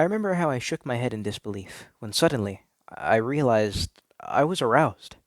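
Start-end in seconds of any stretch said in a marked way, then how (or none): none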